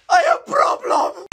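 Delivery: high, slow pretend cry; mock distress. Boy who hurt his back, fake crying. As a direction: whimper it out in fake pain.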